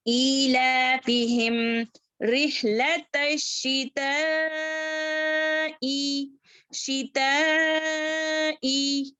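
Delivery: शिताई with a long stretched ई, very slow; four-count madd. Shown as a demonstration. Teacher stretches the madd for students, slow recitation. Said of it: "चार हरकत लंबा करेंगे".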